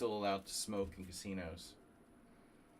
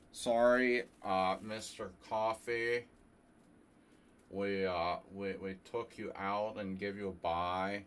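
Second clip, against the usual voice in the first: louder, slow put-on official voice; lightly voiced tournament director; 6 dB louder, slower than usual. Streamer voices a tournament director apologizing to a player, mock official. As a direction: apologize like a dopey camp counselor running a tournament. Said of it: "Combination of Bill Goichberg and South Park counselor".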